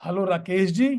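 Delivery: "Hello Rakesh जी" with a rise on जी, as a question. Shown as a demonstration. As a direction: lift the end of "Rakesh जी" to check who it is. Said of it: "questioning accent".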